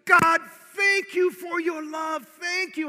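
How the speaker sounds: slow, loud, high shout of thanks; shouting praise. Retold or imitated. Doug shouting thanks to God, loud and slow. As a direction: belt it to God, slowly. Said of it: "he starts shouting".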